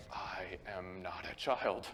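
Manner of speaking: low, slow, soft mock warning; slow, hushed retold protest; mock indignation. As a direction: imitate quiet offence, word by word.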